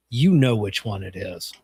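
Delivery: low, knowing retold answer; knowing deadpan. Caller answers which girl up front, knowing deadpan. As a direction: drop the pitch, state the obvious.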